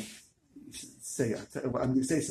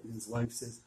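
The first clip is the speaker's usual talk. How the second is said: normal talking voice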